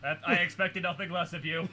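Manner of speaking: chuckling through the line; amused, laughing as you speak. Real, not acted. Amused by the one-line cameo.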